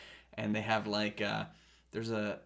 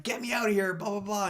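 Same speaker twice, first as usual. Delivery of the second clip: mock plea; 9 semitones higher, 3 dB louder than usual. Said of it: "and he was like".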